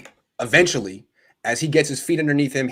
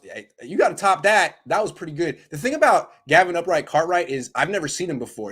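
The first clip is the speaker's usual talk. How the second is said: ordinary talk, nothing marked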